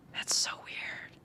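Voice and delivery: slow hushed whisper. Real, not acted. Baffled by the match's profile, whispered aside. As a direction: whisper it to yourself, slowly. Puzzled aside while playing.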